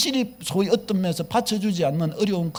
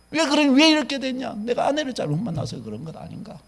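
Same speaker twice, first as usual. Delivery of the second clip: usual speaking voice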